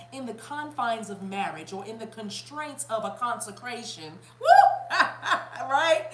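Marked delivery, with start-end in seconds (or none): 4.4-5.0 high, loud "woo"; excited whoop. Real, not acted. Reader thrilled by her own wording, excited whoop. 5.0-5.6 short burst of laughter. Real, not acted. Reader tickled by her own wording, laughing.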